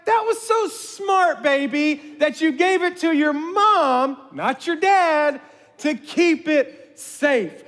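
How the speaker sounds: high, slow, overdone praise aimed at wife; hammed-up praise. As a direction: gloat through sweetness. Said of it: "I'm gonna ham this up".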